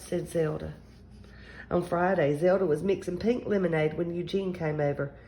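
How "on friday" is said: ordinary delivery, nothing marked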